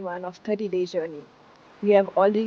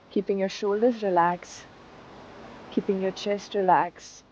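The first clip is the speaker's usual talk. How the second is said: calm, unhurried meditation guide; guided meditation; 8 dB quieter than usual. Acted. Teacher guiding students into seated meditation, calm. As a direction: settle them into stillness.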